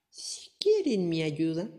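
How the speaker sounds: put-on slow, knowing old woman; a wise granny offering help. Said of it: "repuso la anciana".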